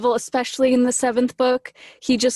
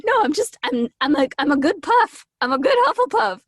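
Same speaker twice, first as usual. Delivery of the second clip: much higher, flustered defending herself; high flustered protest; 6 semitones higher than usual. Retold or imitated. Retelling how she protests she's not flirting, high and flustered. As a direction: squeak out that she's just a nice Hufflepuff. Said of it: "So I'm like".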